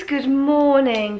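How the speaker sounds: high, slow, drawn-out greeting; sing-song good morning. Real, not acted. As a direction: stretch it out like a sung hello. Greeting repeated, first drawn out.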